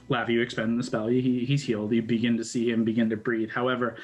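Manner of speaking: ordinary delivery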